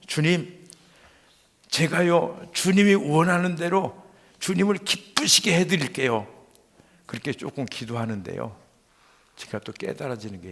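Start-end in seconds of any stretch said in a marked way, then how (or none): 0.1-6.3 tag-less raised prayer. Retold or imitated. Retold prayer swells into earnest promise.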